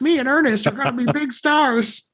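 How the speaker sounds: put-on high eager little kid. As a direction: play a starstruck punk kid. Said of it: "you're just like".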